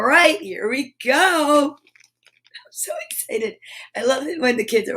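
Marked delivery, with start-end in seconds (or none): 1.8-3.6 slow, breathless whisper; thrilled whispered confession; excited whisper. Real, not acted. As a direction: hush it with giddy excitement. Excited whisper before reveal.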